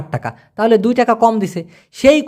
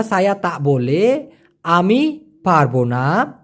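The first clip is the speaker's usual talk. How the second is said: slow teaching; much slower than usual. Shown as a demonstration. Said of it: "ঠিক এভাবেই বলে".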